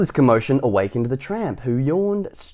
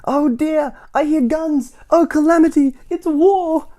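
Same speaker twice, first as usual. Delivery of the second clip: very high, loud panicked wail; hammed-up panic; 9 dB louder, slower than usual. Mister Jelly under the kitchen table, wailing in panic. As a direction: cower and wail about war. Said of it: "cried Mister Jelly".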